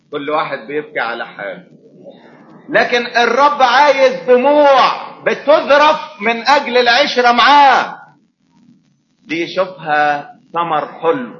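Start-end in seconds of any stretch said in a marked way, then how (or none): 2.7-8.0 high, loud and slow preaching; slow, ringing sermon proclamation; emphatic preaching. Acted. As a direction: proclaim each word slowly.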